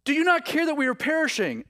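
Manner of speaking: high, fast frantic cry; a panicked plea over the storm. Retold or imitated. Disciples crying out to the sleeping Jesus, high frantic cry. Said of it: "so they're crying out".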